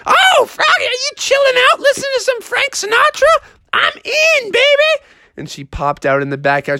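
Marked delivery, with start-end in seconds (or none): 0.1-5.0 very high falsetto mother voice; singsong mom. Acted. Froggy's mom invites herself to chill, excited falsetto. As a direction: trill it high from the house. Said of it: "his mom singing".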